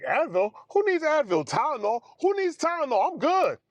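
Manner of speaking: high, boastful Superman swagger; patient bragging he needs no painkillers, high and cocky. Retold or imitated. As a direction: crow like nothing can hurt you. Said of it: "I was like".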